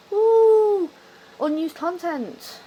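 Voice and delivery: gasping, drawn-out fake wonder; mock awe. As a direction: hype it up like a big mystery. Mocking hyped-up mystery claims.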